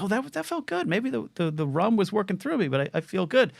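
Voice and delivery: high, light voice replaying his happy self; mock high self-quote. Comic telling himself after the show, high and light. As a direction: lift the voice way up for a giddy inner voice. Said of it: "I'm like".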